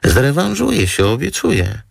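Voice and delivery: high, imploring promise; wheedling, high-voiced plea; pleading. A narrator's reading. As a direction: beg sweetly, pitched right up. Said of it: "złożyła dłonie w błagalnym geście".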